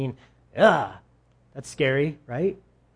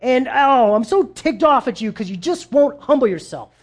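high, loud, fed-up rant at Pharaoh; exasperated outburst; 7 semitones higher, 6 dB louder than usual. Retold or imitated. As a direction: scold him like an angry prophet who has run out of patience. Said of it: "and he's like".

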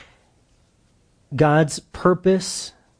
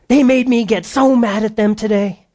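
higher, louder exasperated complaint; frazzled wife; 7 semitones higher, 4 dB louder than usual. Retold or imitated. Pastor voicing a frazzled wife complaining about the kids. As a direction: vent like a worn-out mom.

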